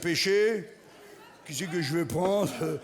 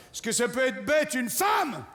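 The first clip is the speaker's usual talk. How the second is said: shouted taunt; 5 semitones higher than usual. Shown as a demonstration. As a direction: bark it out as a challenge. Shouted version of the line.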